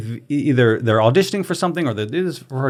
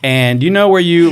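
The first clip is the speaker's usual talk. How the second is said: ordinary talk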